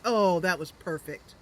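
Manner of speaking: usual speaking voice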